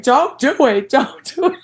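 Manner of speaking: laughing plea. Retold or imitated. Lisa warning her friend, laughing loud plea. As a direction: beg her to stop while cracking up. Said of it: "And Lisa's like".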